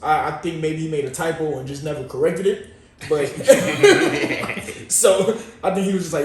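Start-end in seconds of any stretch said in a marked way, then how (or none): 3.3-4.8 high breathy laugh bursting out; burst of laughter. Real, not acted. Laughing at [name]'s typo, a high burst of laughter. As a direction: crack up at the typo.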